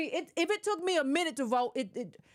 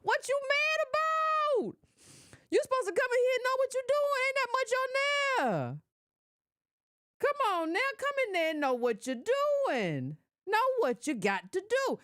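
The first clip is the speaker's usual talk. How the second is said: mock scolding; 10 semitones higher than usual. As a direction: scold like an impatient aunt. Acted scolding of grumbling voter.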